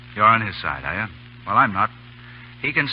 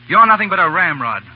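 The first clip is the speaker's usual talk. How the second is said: rising to a loud, higher furious shout; angry outburst; 7 semitones higher, 6 dB louder than usual. Acted. Junior officer defying his colonel over the kidnapped son, angry shout. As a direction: let your contempt explode at him.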